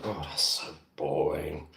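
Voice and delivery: low, slow groan of boredom. Acted. Reacting to his own plain introduction, bored. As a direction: drag it out, softly fed up. Mocking a dull self-introduction.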